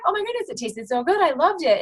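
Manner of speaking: high, delighted customer voice; a happy customer gushing about the drink. Pretend. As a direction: play the thrilled customer. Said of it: "they're gonna come back".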